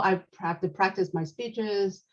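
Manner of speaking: ordinary talk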